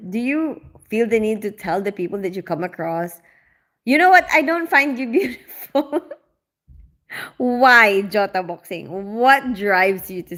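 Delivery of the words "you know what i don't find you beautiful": louder, higher mock put-down breaking into laughter; mock blunt remark. Mimicking a commenter telling passers-by off, mock blunt and giggly. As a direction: pretend to insult a stranger, then crack up.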